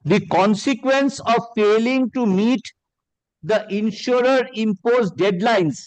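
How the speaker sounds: slow, deliberate reading aloud; slow, measured courtroom reading; read slowly. Shown as a demonstration. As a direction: weigh every word of the letter. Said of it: "I'll read this slowly".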